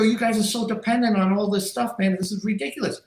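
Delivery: lifted high scolding voice; mocking scolding. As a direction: scold them like silly kids. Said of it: "I go".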